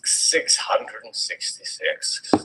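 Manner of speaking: ominous whisper. A narrator's reading. Ominous number in reading.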